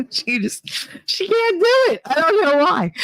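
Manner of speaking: high voice breaking up with amusement; laughing helplessly. Real, not acted. Telling of mother failing at Spanish, laughing. Cracking up over mother's failed attempts.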